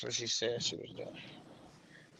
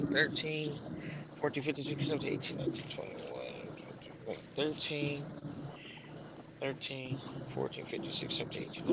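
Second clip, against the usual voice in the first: muttered counting; 10 dB quieter than usual. Real, not acted. Counting to himself on schedule.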